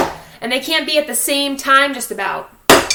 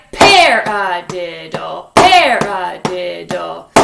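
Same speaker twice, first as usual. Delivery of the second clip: PARadiddle with the first hit punched, slowly; accent on first syllable; 6 semitones lower, much slower than usual. Shown as a demonstration. Teacher showing the accented first hit. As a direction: lean hard on the start of Paradiddle. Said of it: "good accent for the first hit".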